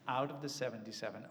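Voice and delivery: usual speaking voice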